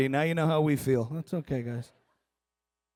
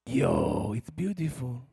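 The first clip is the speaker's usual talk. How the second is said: lightly voiced low, hushed wonder; awed Israeli guy; 6 semitones lower, 5 dB quieter, much slower than usual. Israeli onlooker admiring rockets, quietly awed. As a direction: drop into soft mock awe. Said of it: "some Israeli guy going".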